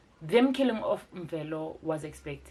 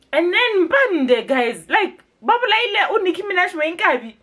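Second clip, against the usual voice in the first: upset outcry; 11 semitones higher, 9 dB louder than usual. Real, not acted. Fan mourning Mpande's death, high, loud outcry. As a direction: cry out her names in dismay.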